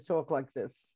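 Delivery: mock Brooklyn talk; Brooklyn accent. Guest showing what family avoided, Brooklyn accent. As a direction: lean into Mom's Brooklyn vowels. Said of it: "She's from Brooklyn".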